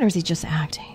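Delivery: hushed whisper to himself. Retold or imitated. Host voicing his private suspicion while watching the movie, in a whisper. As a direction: whisper the doubt as if not to be overheard.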